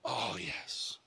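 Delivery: soft, whispered concession; hushed realization. Acted. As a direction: let it dawn on you under your breath. Man quietly concedes again.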